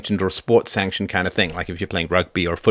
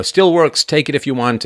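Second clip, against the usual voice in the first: bright higher call, louder on Still works; cheerful giveaway note; 6 semitones higher, 5 dB louder than usual. Retold or imitated. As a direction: offer the old fridge breezily to passers-by.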